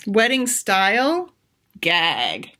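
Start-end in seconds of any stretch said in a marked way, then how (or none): 1.8-2.5 low, dismissive gag; disgusted. Acted. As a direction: sneer at the idea. Maggie reacts to wedding style.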